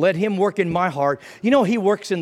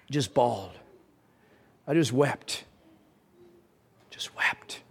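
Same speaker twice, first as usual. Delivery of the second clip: low and hushed, sinking to a whisper; hushed, moved recollection; 5 semitones lower, 7 dB quieter, slower than usual. Real, not acted. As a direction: let it fade to a whispered confession. Recalls weeping after being forgiven.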